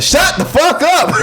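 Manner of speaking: high, slow mock yell; mock outburst. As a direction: snap it out in mock anger. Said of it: "I was like".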